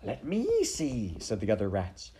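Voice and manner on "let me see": high, drawn-out clamour; eager rat. Acted. Rats pushing and shoving to see, eager clamour. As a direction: stretch it upward, jostling for a view. Rats push and shove to watch.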